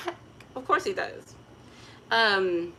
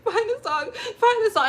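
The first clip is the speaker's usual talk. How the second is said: higher, louder, pleading; desperate repeated plea, much higher; frantic through tears; 7 dB louder than usual. Retold or imitated. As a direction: beg urgently mid-sob. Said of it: "I'm like".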